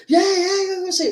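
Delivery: very high mock fan cheer. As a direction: imitate excited fans calling for the fight. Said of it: "his fans are like".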